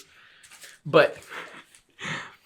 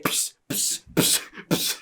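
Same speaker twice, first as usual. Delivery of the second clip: hissed whisper psst sounds; a sneaky whispered psst; 6 dB quieter, much slower than usual. Retold or imitated.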